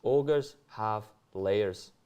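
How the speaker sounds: very slow Scottish ogre voice; drawn-out, comic Scottish delivery; Scottish accent. Acted. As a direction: stretch each word in a Scottish accent. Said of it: "I'll give it a go in Scottish".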